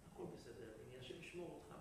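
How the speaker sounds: low, quiet motherly blessing; hushed, calm mother; soft reassurance. Acted. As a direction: settle him gently, very softly. Mother softly reassures her son.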